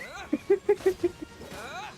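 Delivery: high, rapid giggle. Real, not acted. Streamer joking about passing college with bad English, giggling. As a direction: giggle at your own expense.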